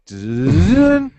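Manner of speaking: rising, swelling "dun" sound; mouthed TV cut-to-break noise; imitated break sting. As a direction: voice a dramatic commercial-break sting, climbing high and loud. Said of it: "It's like".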